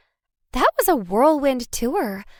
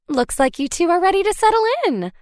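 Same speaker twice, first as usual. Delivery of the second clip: higher, quick welcoming hostess voice; cheerful hostess; 8 semitones higher, faster than usual. A narrator's reading. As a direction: greet the guests brightly and fast. Hostess greets new guests.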